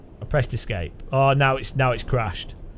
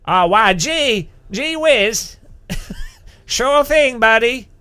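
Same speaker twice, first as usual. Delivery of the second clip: hammed-up, eager American, pitched high; American accent; 5 semitones higher, 3 dB louder, slower than usual. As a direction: play a gee-whiz American caricature. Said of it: "my American accent's pretty damn good".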